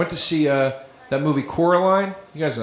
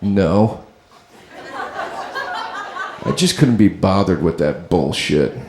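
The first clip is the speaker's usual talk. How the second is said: put-on low, bored, snobbish kid voice; snooty kid; 9 semitones lower than usual. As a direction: drop low and sneer like a bored hipster. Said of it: "the other kid goes".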